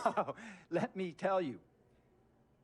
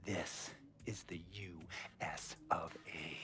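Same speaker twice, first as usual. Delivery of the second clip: hushed, lower whisper; 10 semitones lower, 11 dB quieter than usual. Acted. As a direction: lean in and whisper it. Owner's hushed patriotic aside.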